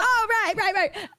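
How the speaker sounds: high-pitched quick acknowledgement; retold realization. Retold sheepish reaction to friend.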